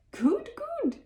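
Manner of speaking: very high screeching voice; crazed high-pitched screech. A narrator's reading. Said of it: "screeched Billy".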